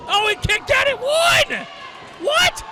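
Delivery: can't believe the kickout; stunned disbelief. Real, not acted.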